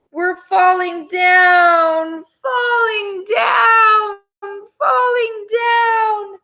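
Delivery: long high wailing cry; falling scream. Acted. Dish and Spoon falling from the beanstalk, high drawn-out wail. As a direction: wail it as you fall.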